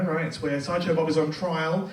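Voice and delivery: ordinary talk, nothing marked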